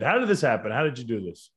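ordinary delivery

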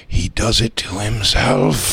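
low, slow confession; deep, drawn-out deadpan; plantation dad. Acted. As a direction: admit it gravely as the father. Acted father answering guest.

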